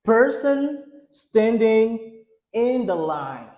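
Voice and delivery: slow, word-by-word enunciation; slow model reading. Shown as a demonstration. Teacher modelling an English sentence for learners, slow model reading. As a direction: say it slowly for learners to repeat.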